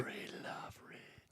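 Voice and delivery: dark, raspy whispered voice. Shown as a demonstration. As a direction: breathe the name like a dark whisper. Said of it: "dark, raspy, whisper of a voice".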